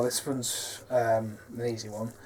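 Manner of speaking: ordinary talk, nothing marked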